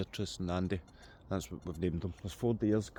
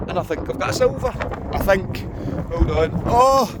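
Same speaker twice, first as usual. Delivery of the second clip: excited discovery; 6 semitones higher, 6 dB louder than usual. Real, not acted. Finds a silver coin.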